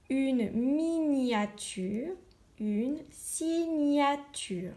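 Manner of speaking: une miniature, une signature read very slowly, stretching each syllable; slow careful reading. Shown as a demonstration. Teacher reading words slowly for her class to hear the gne sound. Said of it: "on entend le son gne".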